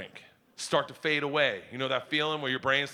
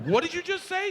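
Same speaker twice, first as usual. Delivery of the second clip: alarmed disbelief; 12 semitones higher than usual. Retold or imitated. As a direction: gasp it in disbelief. Dad alarmed at news.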